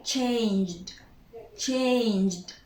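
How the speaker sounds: "changed" slowly, as one syllable; silent E. Shown as a demonstration. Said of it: "we do not pronounce the E".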